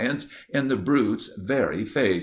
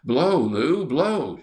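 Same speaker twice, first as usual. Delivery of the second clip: loud, higher urgent shout; 6 semitones higher, 4 dB louder, slower than usual. A narrator's reading. Rector shouts at Lou to blow the clarinet, loud and urgent. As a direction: yell the order over the chase. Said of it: "shouted the rector".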